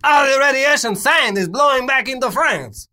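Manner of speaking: put-on high, loud panicked French accent; hammed-up French wailing; french chef. Voicing panicked French people.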